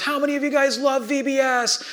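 put-on high-pitched call to a roomful of kids; rallying teacher. Teacher rolling with it to fifty kids, rallying call. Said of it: "teacher's in there trying to hush down".

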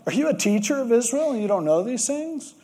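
Jesus gently scolding Nicodemus; mildly hard on him. Retold or imitated. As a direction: needle the teacher a little. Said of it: "Jesus is kind of hard on him".

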